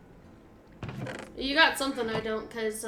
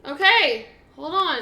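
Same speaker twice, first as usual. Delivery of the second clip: sharp, loud and higher; urgent loud; 4 semitones higher, 9 dB louder, slower than usual. Real, not acted. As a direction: urgently call for a pause. Panicked in scary place.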